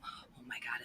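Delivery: normal talking voice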